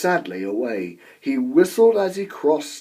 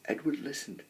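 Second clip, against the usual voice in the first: plain reading voice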